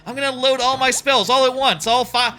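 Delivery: high, loud, gleeful rush; giddy hero about to unload every spell; so excited. Retold or imitated. Hero gleefully telling the demon he'll unload every spell. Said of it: "it's, like, so excited".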